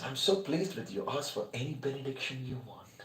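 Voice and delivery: hushed, unhurried blessing; serene deity. Acted. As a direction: speak as a peaceful god, barely audible. Lord Shiva offers a boon.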